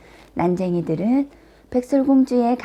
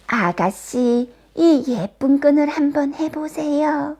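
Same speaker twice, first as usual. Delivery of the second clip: drawn-out, higher-pitched peddler voice; disguised queen's wheedling sales pitch; old peddler woman; 6 semitones higher than usual. A narrator's reading. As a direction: coax the young lady to try.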